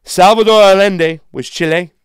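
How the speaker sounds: loud sharp voice; yelling. Real, not acted. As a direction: shout out each name and country. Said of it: "sorry for yelling so loud".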